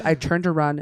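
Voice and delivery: usual speaking voice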